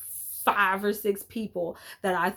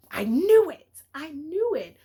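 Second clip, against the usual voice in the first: higher and louder, triumphant gloating; 8 semitones higher, 5 dB louder than usual. Real, not acted. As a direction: burst out proud of guessing right. Recalling guessing the twist.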